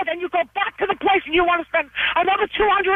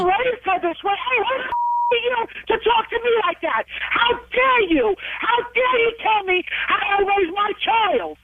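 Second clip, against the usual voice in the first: how-dare-you anger, stressing each word; real outrage; 4 semitones higher than usual. Real, not acted. Mother outraged at insult to parenting.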